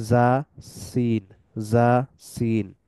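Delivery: জা, সিন drawn out slowly as Arabic letters; letter demo. Shown as a demonstration. Teacher slowly sounding জা and সিন for students. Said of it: "জা, সিন এবং সাদ এই তিনটাই".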